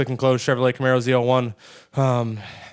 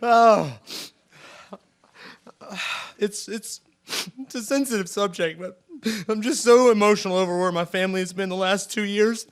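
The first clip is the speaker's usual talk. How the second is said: emotional; 6 semitones higher than usual. Real, not acted. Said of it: "Pull it together, bud".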